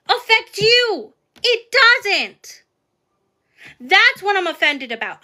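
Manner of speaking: angry yelling, pitch climbing. Real, not acted. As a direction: shout down the fat-shaming commenter. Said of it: "I'm yelling".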